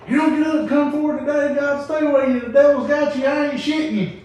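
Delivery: loud, high hellfire preacher; preacher hollering at the congregation. Retold or imitated. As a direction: shout it like a fired-up country preacher. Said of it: "He said".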